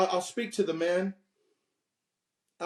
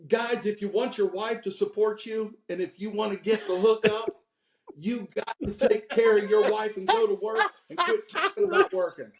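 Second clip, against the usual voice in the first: motivational exhortation; 5 semitones higher than usual. Retold or imitated. Speaker urging husbands at a conference, building exhortation. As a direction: build to a high, forceful push. Re-enacting his forceful conference speech.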